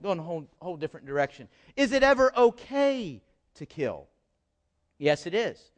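ordinary talk

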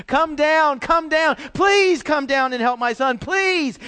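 loud, high pleading, voice straining; a father begging Jesus loudly to save his son; desperate begging. Retold or imitated. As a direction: beg like a father whose son is dying. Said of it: "continually begging ... he was saying".

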